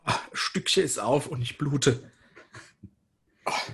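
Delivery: pained, slow cry of hurt. Real, not acted. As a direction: wince through the words. Cut himself opening. (vor Schmerz stöhnend)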